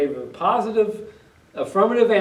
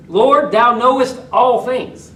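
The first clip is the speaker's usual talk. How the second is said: slow, higher, louder and weighty; emphatic; 8 semitones higher, 9 dB louder, slower than usual. Acted. Peter insisting to Jesus that he knows, slow and emphatic. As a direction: press every word down hard. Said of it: "Peter's got to have some emphasis in this answer".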